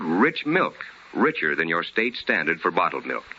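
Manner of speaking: ordinary talk, nothing marked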